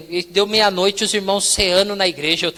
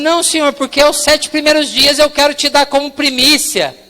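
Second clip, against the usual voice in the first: higher, louder pleading justification; insistent, raised-pitch excuse; earnest protest; 6 semitones higher, 6 dB louder than usual. Retold or imitated. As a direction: imitate someone eagerly arguing their case. Protesting to God after verdict.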